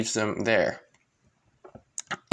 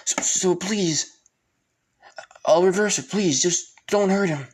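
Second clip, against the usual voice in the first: high, stammering, louder plea; pleading henchman; 8 semitones higher, 4 dB louder than usual. Retold or imitated. Other guy begging Asta to spare him, pleading. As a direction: beg frantically in a raised pitch. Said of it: "With the other guy saying".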